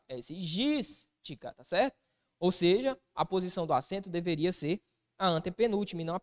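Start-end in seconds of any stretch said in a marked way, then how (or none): 0.4-1.5 "gística" slow, gís held apart from tica; stressed syllable isolated. Shown as a demonstration. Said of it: "Qual que é a sílaba tônica". ("gística" separando o gís, bem devagar)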